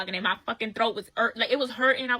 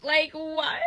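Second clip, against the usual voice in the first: exasperated what; 5 semitones higher, 5 dB louder than usual. Retold or imitated. Disbelief at date rapping trauma.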